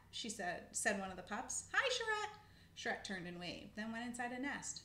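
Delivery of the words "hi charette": high, loud pup's hello; calling out greeting. Acted. Pup greets a squirrel up the tree, calling out. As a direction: sing out a greeting from afar.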